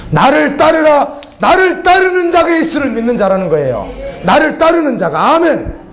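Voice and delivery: fervent sermon cry; proclaiming preaching. Acted. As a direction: ring out the command to follow.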